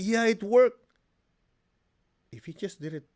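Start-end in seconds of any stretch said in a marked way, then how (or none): 0.0-0.8 high, eager little gush; excited. Retold or imitated. Student on the phone, thrilled it worked. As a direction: beam with excitement. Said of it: "She's so excited".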